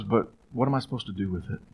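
soft, quick, kind question; imitating his wife's soft sincere query; wife gently asking her husband the key question; gentle question. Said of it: "she just very gently".

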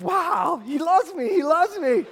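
high, loud, gushing dog voice; delighted dog. Acted. Dog thrilled by owner's loving tone, overjoyed voice. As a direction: burst out like an adored pet. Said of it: "How's your dog gonna respond?".

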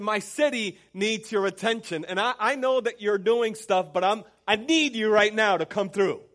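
loud, high, building plea to Jesus; urgent appeal. Acted. People interrupting Jesus for their families, urgent loud appeal. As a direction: interrupt with a rising, forceful cry for attention. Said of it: "gotten in the way and have said".

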